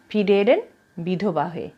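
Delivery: plain reading voice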